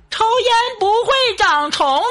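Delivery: high chanted class answer in unison; many voices in unison. Retold or imitated. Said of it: "同学们异口同声的回答".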